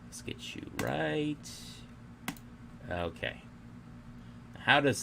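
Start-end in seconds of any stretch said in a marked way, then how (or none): 0.1-1.4 whispered, slow coaxing to the model; soft whisper. Real, not acted. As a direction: murmur it to the screen like soothing a pet. Coaxing the game model to fix.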